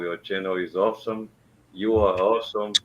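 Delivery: ordinary talk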